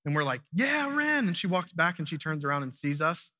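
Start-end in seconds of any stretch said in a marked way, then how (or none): 0.5-1.3 put-on high excited cheer for a child on stage. Parents cheering their shy daughter from the audience, high excited cheer. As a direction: cheer her on, pitched way up.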